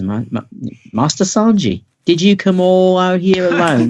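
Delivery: high Cockney maid voice, laughing through it. Acted. Maid greeting Sanji at the window in high Cockney. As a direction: play the maid in a broad Cockney accent. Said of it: "all maids must have a Cockney accent".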